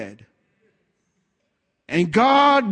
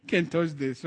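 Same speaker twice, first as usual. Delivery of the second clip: laughing quote; 4 semitones lower, 7 dB quieter than usual. Retold or imitated. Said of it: "I use the word".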